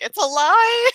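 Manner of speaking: high, loud mock accusation; mock outburst. Fan calls out the actor's denial, a loud mock outburst. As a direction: call it a lie, playfully. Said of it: "I was like".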